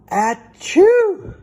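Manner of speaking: loud high mock sneeze; hammed-up storybook sneeze; big sneeze. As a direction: blast out a huge bear sneeze. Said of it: "makes the bear go".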